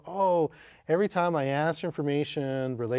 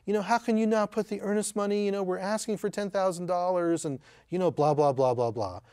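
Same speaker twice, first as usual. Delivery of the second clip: put-on high, condescending lecture from an old pro; mock scolding veteran agent; 7 semitones higher than usual. Veteran agent berating a new realtor, high mock scolding. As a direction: imitate a know-it-all talking down to a rookie. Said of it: "she's like".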